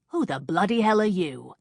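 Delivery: loud, indignant man's demand; a startled man barking at an intruder. A narrator's reading. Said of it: "Jake asked the intruder".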